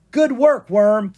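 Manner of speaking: high, loud cheer; excited praise. Acted. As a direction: cheer your dragon on. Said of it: "Drake cried".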